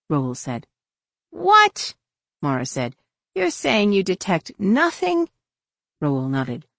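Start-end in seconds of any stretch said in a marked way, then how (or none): 1.3-1.9 very high, loud exclamation; incredulous. A narrator's reading. Mara hearing no anomaly was detected, incredulous. 3.3-5.3 slow, high-pitched disbelief; incredulous. A narrator's reading. Mara challenging Roel's report, incredulous.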